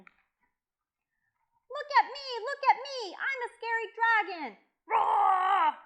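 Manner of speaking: high, loud shout ending in a roar; boastful scary dragon. Acted. Boy trying to scare real dragons, high boastful shouting.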